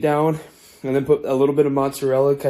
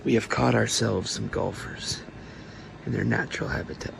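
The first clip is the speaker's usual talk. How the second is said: nature documentary; 3 semitones lower, 7 dB quieter than usual. Pretend. Continues nature documentary bit.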